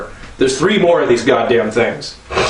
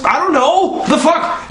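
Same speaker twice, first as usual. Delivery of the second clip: exasperated laugh; 12 semitones higher, slower than usual. Real, not acted. Exasperated rant.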